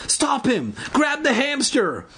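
high, desperate yelling; desperate shout. A narrator's reading. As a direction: call out for help in a panic. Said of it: "Evan shouted desperately".

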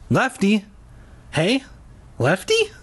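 high, tentative questioning calls; trembling call. A narrator's reading. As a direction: call out uneasily for someone missing. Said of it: "my voice trembling".

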